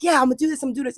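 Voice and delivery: high, anxious self-cheering; stressed hype. Retold or imitated. Imitating her stressed, over-eager self. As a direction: push through the nerves with bravado. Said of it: "stressing out because I was like".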